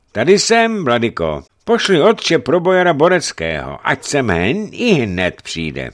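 loud voices through an open door; calling out. A narrator's reading. Archbishop and servant exchanging orders at the door, calling out.

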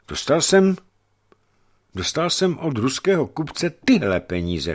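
high, halting start with a repeat; stammering. A narrator's reading. German testifying under the hunchback's pressure, stammering. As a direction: break off, pause, then start over nervously. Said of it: "koktal Němec".

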